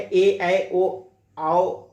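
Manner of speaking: ए, ऐ, ओ, आओ each vowel slowly stretched; vowels drawn out. Shown as a demonstration. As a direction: spell out ए ऐ ओ आओ slowly one by one. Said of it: "अयादी संधि के नियम में दिया है". (स्वरों को धीरे-धीरे खींचकर बोलें)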